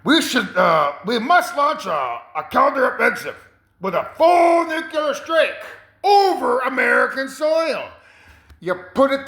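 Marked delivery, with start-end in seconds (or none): 0.0-7.9 urgent military adviser. Retold or imitated. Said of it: "is gonna say".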